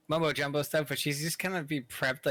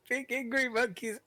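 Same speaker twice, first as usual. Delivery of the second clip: imitated distant scream; 10 semitones higher than usual. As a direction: mimic a far-off scream up high. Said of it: "all you hear is".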